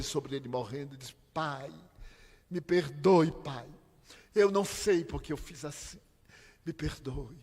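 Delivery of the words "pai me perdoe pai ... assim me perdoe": a louder, higher anguished plea; crying out. Retold or imitated. Daughter over her dying father, crying out for forgiveness. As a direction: cry out begging forgiveness, mildly. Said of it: "Ela começou a gritar".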